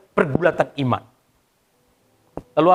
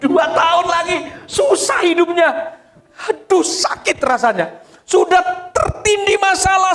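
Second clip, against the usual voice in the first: high, raised cry of dismay; anguished lament; 13 semitones higher, 3 dB louder than usual. Acted. Father hearing of two more hard years, high anguished complaint. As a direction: wail it like an overburdened man.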